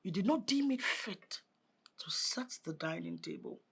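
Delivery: soft, cold telling-off; cold reproach. Acted. Stepmother to Abigail, soft cold reproach. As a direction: list her failings quietly.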